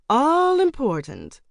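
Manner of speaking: put-on high, slow theatrical older lady; flamboyant, swooping concession; grand agreement. A narrator's reading. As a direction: stretch the first word grandly and loud. Geraldine agrees in grand fashion.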